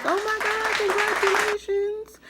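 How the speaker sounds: very high squeal of congratulations; excited coworkers. Retold or imitated. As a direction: imitate coworkers squealing with delight. Coworkers hear the pregnancy news.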